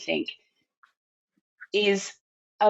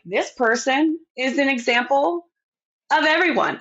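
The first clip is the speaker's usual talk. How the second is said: mock politician; 6 semitones higher than usual. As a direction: parody a politician presenting a token person. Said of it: "and they're like".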